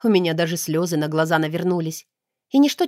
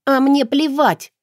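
furious, slow and louder; angry; 7 semitones higher, 5 dB louder, slower than usual. A narrator's reading. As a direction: spit it out in a rage. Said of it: "разъярилась я". (яростно, медленно и громко)

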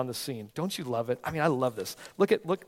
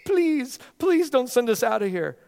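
high, loud pleading of the evil spirits; begging spirits; 10 semitones higher, 7 dB louder, slower than usual. Acted. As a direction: plead like a desperate demon. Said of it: "The evil spirits begged him".

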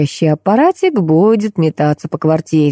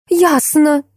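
high-pitched outburst, volume held; mild wail; 10 semitones higher than usual. A narrator's reading. Woman jumps to conclusions, wailing mildly. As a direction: cry it out with pitch leaping up. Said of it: "завопила Гуськова".

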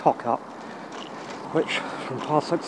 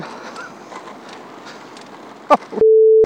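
laughing; 6 dB louder than usual. Real, not acted. Laughing after the whee.

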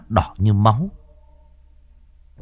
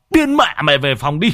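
yelling at son; 7 semitones higher than usual. A narrator's reading. A father yells at his son to go to his room. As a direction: shout him back to his room. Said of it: "Ông quay sang quát thằng con".

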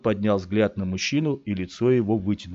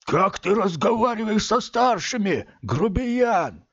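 higher, gruff bark; barked scolding; 12 semitones higher than usual. A narrator's reading. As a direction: snap at the rude boy. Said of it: "и он рявкнул".

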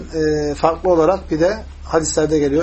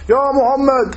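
higher-pitched mock call; imitated shouting; 6 semitones higher, much slower than usual. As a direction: imitate a Bedouin calling the name out. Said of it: "bağırıyor Peygamber Aleyhisselatü Vesselam'a".

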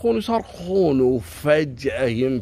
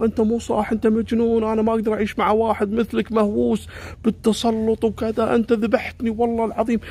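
high, hot accusing voice; angry refusal; 8 semitones higher than usual. Retold or imitated. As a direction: throw the accusations at him.

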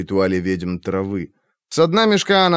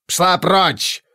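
angry shout; 7 semitones higher than usual. A narrator's reading. Witch drives her away.

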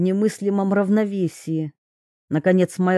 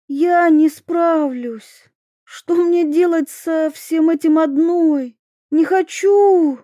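high, slow, near tears; despairing plea; 8 semitones higher, 4 dB louder, slower than usual. A narrator's reading. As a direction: break down in helpless refusal. Princess despairing.

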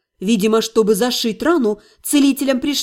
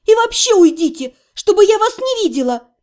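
high, angry dismissal; ordering; 6 semitones higher, 3 dB louder than usual. A narrator's reading. Patient banishing the healer, angry high command. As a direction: send him away with pitch climbing. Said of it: "приказала я целителю".

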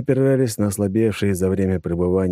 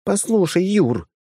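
female character voice; 12 semitones higher, slower than usual. A narrator's reading.

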